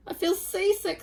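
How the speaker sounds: put-on high, miserable little boy; high sick voice. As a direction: squeak it out, queasy and small. Sick boy tells father.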